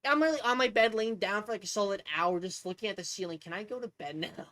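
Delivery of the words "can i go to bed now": soft, low mock pleading; weary plea. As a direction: ask it quietly, worn out, as if begging. Retold plea to own brain.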